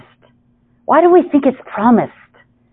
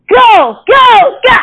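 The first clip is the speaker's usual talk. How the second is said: high, loud yelled urging; hammed-up race-side cheering; cheering spectators; 14 semitones higher, 5 dB louder, much slower than usual. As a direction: yell them on from the stands. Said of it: "they're like".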